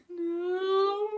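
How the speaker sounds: high, loud mock sob of a no; retelling her own tearful no, loud and high; imitated crying. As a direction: wail the word like you're sobbing. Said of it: "And I'm like".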